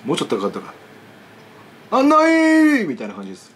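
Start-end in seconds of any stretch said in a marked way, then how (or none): 1.9-2.9 much higher, loud, raspy drawn-out call; raspy old man calling out, pitched high; hoarse high greeting. Retold or imitated. Retrying the old man's hoarse, high greeting. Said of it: "もっとしゃがれてんだけど高い".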